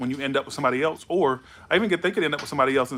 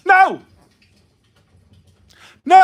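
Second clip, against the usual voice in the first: a sudden, very loud, high scream of no; 13 dB louder than usual. Real, not acted. Shouting no at the ex who isn't ready, full scream. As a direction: scream it out as hard as you can. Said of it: "I just yelled it and screamed it".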